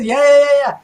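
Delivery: high, loud, rushed agreement; eager yeah yeah. Retold or imitated. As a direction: gush back eagerly. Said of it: "he says".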